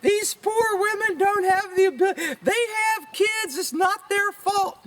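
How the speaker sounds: high, slow mock-outraged wail; mock a scolding activist in a drawn-out high voice; hammed-up high-pitched indignation; indignant women's group. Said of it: "women group out here telling me".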